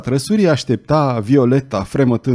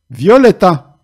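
loud, higher angry bark of her name; snapping shout; 6 semitones higher, 7 dB louder, slower than usual. A narrator's reading. Belgoder snapping at Violeta, angry and loud. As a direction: snap at her like a brute. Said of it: "se răsti Belgoder".

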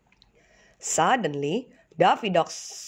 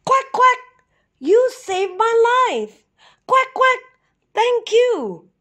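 much higher, thankful duck voice; grateful cold duck; 4 dB louder than usual. A narrator's reading. The rescued duck thanks the flock as he flies up, high and grateful. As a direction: call up your thanks to the flock. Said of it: "he called".

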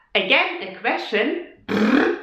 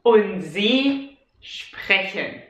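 Und sie sprechen said slowly; 3 semitones lower, much slower than usual. Shown as a demonstration. Teacher finishing the conjugation slowly. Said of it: "So let's say it slowly".

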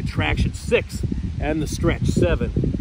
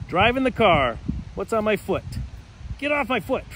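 normal talking voice

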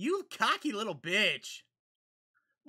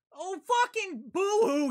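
mocking shout; 13 semitones higher, 6 dB louder than usual. Real, not acted. Mocking crying character.

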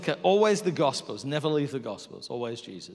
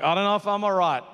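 overwhelmed; 4 semitones higher, 7 dB louder than usual. Retold or imitated. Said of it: "I'm like".

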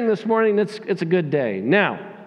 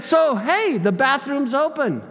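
pointed hint; 7 semitones higher than usual. Retold or imitated. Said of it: "And I said".

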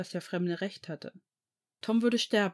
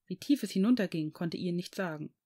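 usual speaking voice

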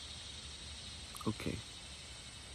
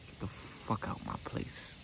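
hissed dismissal; 3 semitones higher, 8 dB quieter, slower than usual. Retold or imitated. Telling Boss to leave.

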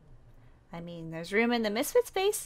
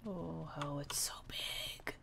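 soft, slow unvoiced awe; awed whisper; 4 semitones lower, 14 dB quieter, slower than usual. Girl whispers in awe at the huge airport. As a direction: breathe it out in wonder.

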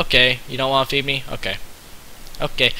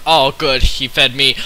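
usual speaking voice

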